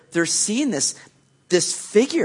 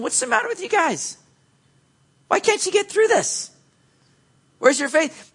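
mock scolding; 14 semitones higher than usual. Imagined scolding Jesus denies.

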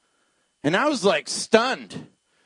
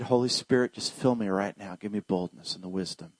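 soft, low murmured prayer; hushed plea for boldness; quiet prayer; 10 semitones lower, 8 dB quieter than usual. Retold or imitated. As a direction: pray under your breath. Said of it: "So I prayed".

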